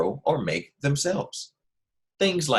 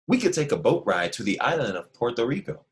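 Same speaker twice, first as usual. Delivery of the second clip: usual speaking voice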